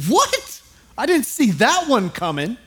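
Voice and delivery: squeaked high, incredulous what; mock shock. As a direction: act stunned, voice leaping up. Reacting to shocking dinner line.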